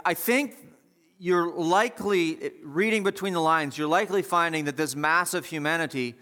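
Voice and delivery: ordinary delivery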